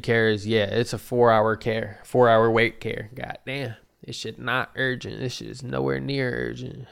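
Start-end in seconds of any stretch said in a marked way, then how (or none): none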